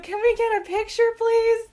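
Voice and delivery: high, pleading mom imitation; mom asking. Mom asking Andy Murray for a photo, high pleading voice. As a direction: ask sweetly and high, like an eager mom. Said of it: "my mom's like".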